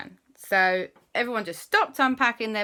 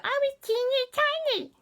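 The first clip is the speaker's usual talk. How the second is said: put-on much higher squeaky "I was teeny tiny"; tinier squeak. Shown as a demonstration. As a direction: shrink the voice right down. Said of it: "or rather".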